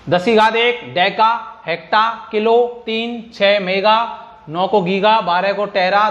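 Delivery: slow, deliberate listing of prefixes; teacher drilling a list at dictation speed; slow recital. Shown as a demonstration. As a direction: spell each prefix out slowly for students.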